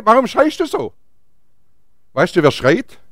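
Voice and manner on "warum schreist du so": higher-pitched, emphatic asking; raised insistent question. Acted. Same question repeated, raised. (mit erhobener Stimme gefragt)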